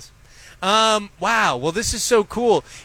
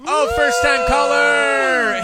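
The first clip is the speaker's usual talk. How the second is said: drawn-out, higher delighted call; excited shout; 6 semitones higher, much slower than usual. Real, not acted. Host hyping a first-time caller on air, slow excited shout.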